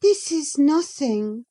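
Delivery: put-on a young lady answering back, pitched high; Catherine. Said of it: "cried she".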